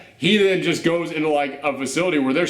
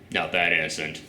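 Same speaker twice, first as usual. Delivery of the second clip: imitating the president; 12 semitones lower, 5 dB quieter than usual. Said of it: "And the president just goes".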